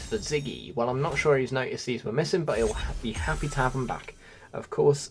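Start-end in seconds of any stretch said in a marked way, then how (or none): none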